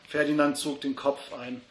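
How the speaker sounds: usual speaking voice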